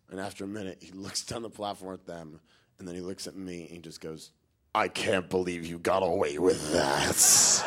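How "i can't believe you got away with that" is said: low, loud, slow British voice; incredulous British man. Retold or imitated. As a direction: draw it out low in British disbelief. British man's amazed verdict on the bluff.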